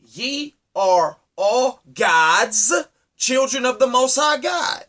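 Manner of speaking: drawn-out, solemn quoting of the psalm; slow solemn quote from David; slow scripture quote. Retold or imitated. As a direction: stretch the first words slowly like reading scripture. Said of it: "who said".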